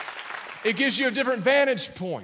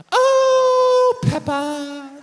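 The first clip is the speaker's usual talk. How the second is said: high, bright-toned British mum voice, much louder; hammed-up cartoon mum calling her piglet; Mama Pig; 7 dB louder, much slower than usual. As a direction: sing out like a cartoon pig mother. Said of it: "That's Mama Pig".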